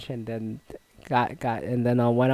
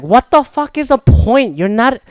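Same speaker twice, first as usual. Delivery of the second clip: loud, high, incredulous ranting at the jersey guys; fed-up mock tirade; exasperated rant; 5 semitones higher, 5 dB louder than usual. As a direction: vent the outrage, loud and high. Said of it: "And I'm like".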